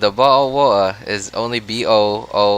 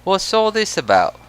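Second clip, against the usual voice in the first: put-on British accent; 10 semitones higher than usual. British accent attempt for chat.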